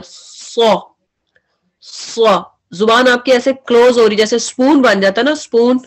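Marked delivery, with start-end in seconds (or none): 0.0-2.5 सौ said thick, the second time nearly whispered; सौ with the tongue touching the upper palate; heavy letter demo. Shown as a demonstration. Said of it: "उसके साथ बिल्कुल touch हो रही है".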